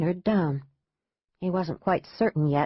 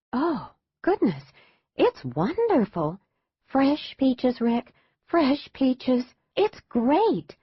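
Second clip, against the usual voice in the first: higher, gushing over the taste, slow on fresh peaches; delighted mother; 7 semitones higher, slower than usual. A narrator's reading. Mother raving to her husband about peaches, higher and gushing. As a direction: swoon over the ice cream.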